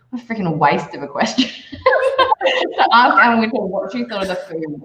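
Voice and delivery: exasperated line dissolving into laughter; laughing disbelief. Retold or imitated. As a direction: scoff at it while laughing. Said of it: "I was like".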